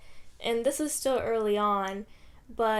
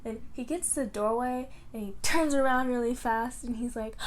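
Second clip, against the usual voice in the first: ordinary talk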